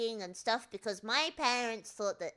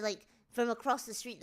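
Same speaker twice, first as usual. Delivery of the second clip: ordinary talk, nothing marked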